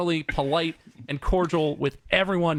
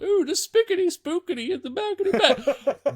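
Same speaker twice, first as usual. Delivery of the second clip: much higher, sing-song plumber nonsense; silly plumber voice; 13 semitones higher than usual. Retold or imitated. Friend mocking a plumber's nonsense jargon, high and sing-song. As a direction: prance through the made-up words. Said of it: "he was all".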